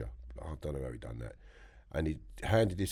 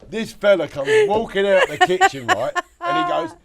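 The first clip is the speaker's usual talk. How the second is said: laughing, much louder and higher; laughing while telling; 17 dB louder than usual. Real, not acted. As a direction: talk through rising laughter.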